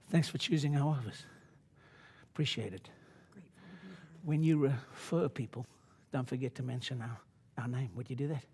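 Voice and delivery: quiet courteous patter; soft office voice. Shown as a demonstration. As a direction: hush it down like at the front desk. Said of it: "I don't normally talk like this".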